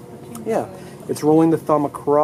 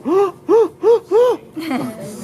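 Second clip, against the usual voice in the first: high, hammed-up gasp of surprise; mock gasp; 4 dB louder than usual. Teacher fakes a shocked gasp for the class.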